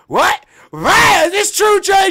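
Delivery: acted screaming disbelief, very high; shocked shouting. Asking Jay about the cheating, hammed-up shocked shout. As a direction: shriek it as if you can't believe it. Acts out his shocked reaction.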